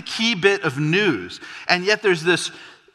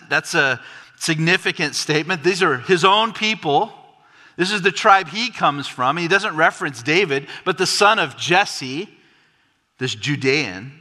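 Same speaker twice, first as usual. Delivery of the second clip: usual speaking voice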